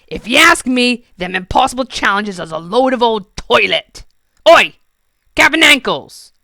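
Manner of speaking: loud rough goblin call. Acted. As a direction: holler across the cave. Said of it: "he called".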